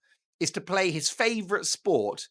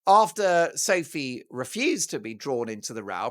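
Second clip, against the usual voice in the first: ordinary talk, nothing marked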